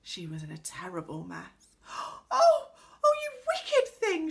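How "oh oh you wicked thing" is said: very high, loud, aghast cry; outraged cry. A narrator's reading. Girl drenched in ink cries at the pixie, outraged. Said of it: "she cried".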